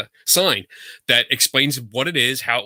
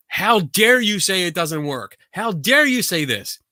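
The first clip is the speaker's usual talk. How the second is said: mock screaming outrage; 5 semitones higher than usual. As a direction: imitate an outraged woman scolding. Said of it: "she's screaming at me, saying".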